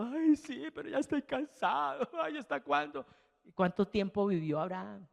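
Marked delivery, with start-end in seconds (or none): 0.0-3.0 higher, lightly whimpering complaint; a hint of a tired believer about to cry; mock weary whining believer. Preacher imitating a worn-out believer's tearful lament. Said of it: "y uno ahí llorando".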